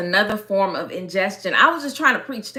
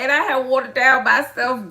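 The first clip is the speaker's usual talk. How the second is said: usual speaking voice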